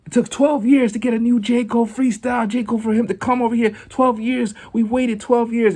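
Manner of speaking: high, fast, thrilled DJ voice; excited DJs. Retold or imitated. Radio DJs gushing over a rare guest, high and fast. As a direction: gush about the long wait. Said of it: "So they're like".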